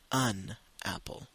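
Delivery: "an apple" with the n linking into apple. Shown as a demonstration. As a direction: say "an", not "a", before "apple". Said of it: "il faut dire an apple". (dire « an apple » en liant le n)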